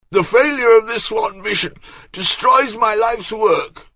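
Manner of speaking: put-on high, loud wailing lament of a fussy old man; despairing old courtier. A narrator's reading. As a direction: cry out that all is ruined.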